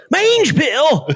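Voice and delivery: high, disbelieving question; mock-alarmed echo; high startled repeat of the pill; incredulous. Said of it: "Eric goes".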